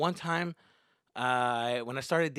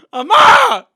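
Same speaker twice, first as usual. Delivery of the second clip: high, very loud yell for mom; acted angry yell; 25 dB louder than usual. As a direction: burst out yelling, high and loud. Acted angry arrival home to mother.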